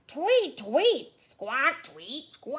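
loud, high bird squawks; tweeting, squawking bird. A narrator's reading. Bird pestering Theodore at his cave, loud tweets and squawks. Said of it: "It tweeted and squawked".

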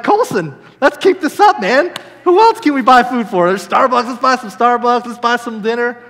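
high, loud, eager pleading; comic over-eager excitement; giddy urging. Retold or imitated. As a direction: rattle off ideas to your son, thrilled. Eagerly urges son to keep giving.

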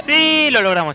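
loud, high celebration; triumphant cheer. Real, not acted. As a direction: shout the win out to the viewers. Celebrating a success in the game. (festejo eufórico a los gritos)

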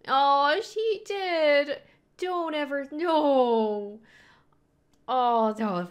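high, loud protest at the screen; upset cry. Real, not acted. Viewer protests loudly as the toy is ripped. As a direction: cry out in dismay.

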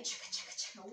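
whispered chugging train noise; train chugging sound. Pretend. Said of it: "Like a choo-choo train".

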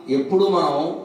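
ordinary talk

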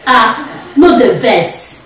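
ah no the verse, slowly; slow demo phrase. Shown as a demonstration. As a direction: draw it out. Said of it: "okay, say".

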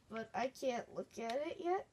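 ordinary talk, nothing marked

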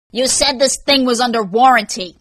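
loud, high indignant complaint; angry customer outburst; raging. A narrator's reading. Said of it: "he raged".

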